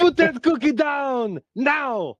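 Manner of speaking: Arnold Schwarzenegger impression. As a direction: bark it like Schwarzenegger. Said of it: "Arnold Schwarzenegger ... when he says".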